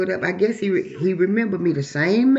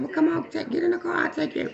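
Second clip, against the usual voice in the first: pitched up, coaxing come-on; high mock invitation; 10 semitones higher than usual. Man coaxing her into his car, higher coaxing voice.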